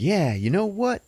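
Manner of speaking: high-pitched defiant stoner; high, reckless stoner bravado; stoner guy. Retold or imitated. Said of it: "the guy's like".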